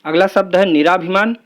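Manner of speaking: ordinary talk, nothing marked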